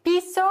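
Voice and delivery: Piso with an ordinary hissed S; normal S sound. Shown as a demonstration. Teacher isolates the word, plain S in Piso. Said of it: "ganz normal mit S".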